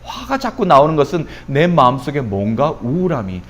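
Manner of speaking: ordinary talk, nothing marked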